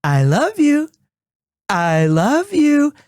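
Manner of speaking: put-on dad cooing to his baby son; tender baby talk. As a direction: coo it lovingly to your son. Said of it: "I will tell him".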